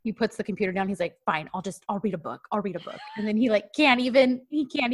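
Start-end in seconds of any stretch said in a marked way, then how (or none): 1.2-3.0 softer, lower retold grumble; grudging, subdued. Host retells the seething man putting computer down, grudging mutter. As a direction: give in grudgingly under the breath.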